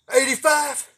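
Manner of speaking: high, loud incredulous call; father shouting in disbelief; shouted guess. Retold or imitated. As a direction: yell the number at the boy.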